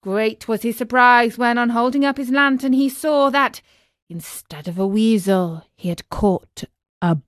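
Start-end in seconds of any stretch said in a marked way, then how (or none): none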